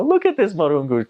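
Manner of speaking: high-pitched amazed onlooker; imitated local's high-pitched astonishment; delighted local. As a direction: exclaim high, pointing out a marvel. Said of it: "They go".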